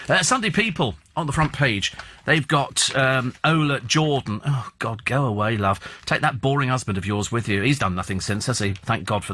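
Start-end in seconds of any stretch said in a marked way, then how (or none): none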